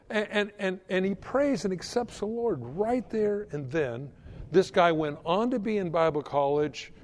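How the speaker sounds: ordinary talk, nothing marked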